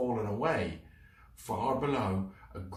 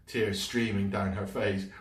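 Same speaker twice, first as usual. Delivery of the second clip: usual speaking voice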